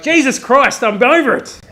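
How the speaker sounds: loud, high exasperated outburst; half-laughing loud groan of a sleepless parent; desperate cry. Retold or imitated. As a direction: blurt it out at the end of your rope. Exhausted parent's desperate prayer.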